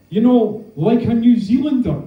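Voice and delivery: imitating a caller's Kiwi accent; mock Kiwi accent.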